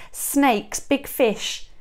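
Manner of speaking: ordinary delivery, nothing marked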